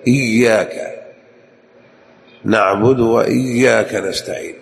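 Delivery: إياك and وإياك with held double ي; geminated ya in إياك; doubled ya. Shown as a demonstration. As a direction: lean into each ya of إياك. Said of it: "يعني يشدد يقول".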